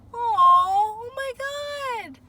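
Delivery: very high, slow, drawn-out squeal; high-pitched voice. Real, not acted. Reading the cards, overwhelmed, squeals in a very high voice. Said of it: "not that high-pitched voice".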